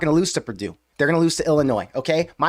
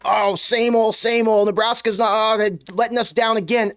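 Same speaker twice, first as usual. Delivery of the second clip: higher-pitched imitation of a moaning fan; mock whining; 4 semitones higher than usual. Host mimicking disappointed fans posting on Twitter, mock whining. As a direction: parody a let-down fan's tired complaint.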